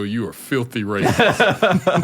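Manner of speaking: slow, loud, mock-outraged verdict; emphatic accusation. Delivering the punchline to his boss, slow loud mock accusation. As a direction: drag out every word like a judge.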